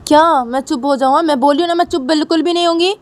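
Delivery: high, loud defiant retort; defiant outburst. Retold or imitated. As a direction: shoot back, refusing to be silenced. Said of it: "तभी शनाया बोलती".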